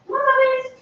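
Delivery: high sing-song call to the puppies. Real, not acted. Owner calling her puppies, high sing-song call. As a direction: coax them over in a high call.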